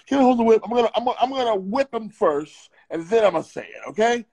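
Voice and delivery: ordinary talk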